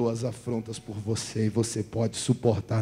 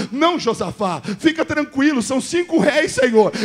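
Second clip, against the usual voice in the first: shouted higher and higher to a crying roar; God's voice thundering; 10 semitones higher, 18 dB louder, slower than usual. Acted. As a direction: boom out God's promise to the king. Eu lembro de Josafá.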